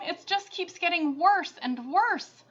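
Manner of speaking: a mother near sobbing; distressed cry. Acted. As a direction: lament it, close to tears. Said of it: "cried Missus Cream".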